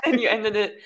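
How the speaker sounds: usual speaking voice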